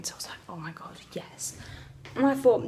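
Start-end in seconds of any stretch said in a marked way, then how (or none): 0.0-1.6 hushed, much softer aside; whispered relief. Real, not acted. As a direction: breathe it out like a secret.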